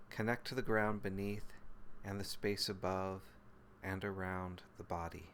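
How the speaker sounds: calm, even meditation voice with long pauses; guided meditation. Real, not acted. Teacher leading listeners through body and breath, guided meditation. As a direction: guide them gently into the body, phrase by unhurried phrase.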